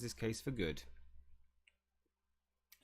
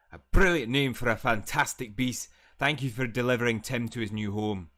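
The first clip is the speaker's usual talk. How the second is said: booming, much louder professor, full of welcome; hearty professor voice; 10 dB louder than usual. Acted. Streamer voicing Professor Kettleburn welcoming Tim, loud and hearty. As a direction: boom it out like a jolly teacher greeting a beast.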